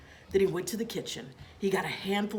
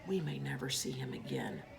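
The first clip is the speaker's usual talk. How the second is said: hushed, breathy whisper of worry; 8 dB quieter than usual. A narrator's reading. Father warns the kids in a grave whisper. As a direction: drop to a whisper.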